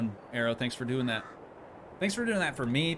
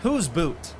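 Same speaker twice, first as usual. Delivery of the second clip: put-on Canadian accent; 4 semitones higher, 4 dB louder than usual. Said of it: "They would, they would say a boot".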